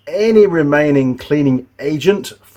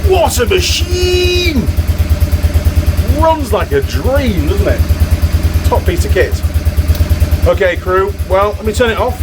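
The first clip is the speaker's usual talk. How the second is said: high, raised voice over a running engine; 8 semitones higher, 4 dB louder than usual. Real, not acted. Mechanic praising the running bike to viewers, raised high voice. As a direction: call out over the motor noise.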